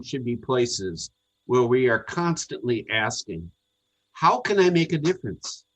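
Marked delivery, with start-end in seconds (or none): none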